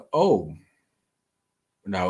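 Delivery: usual speaking voice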